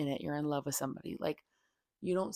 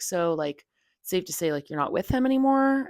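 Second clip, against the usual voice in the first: retold surprised question; 2 semitones higher, 7 dB louder than usual. Doctor asking the patient about her cheater, rising louder disbelief. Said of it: "she was like".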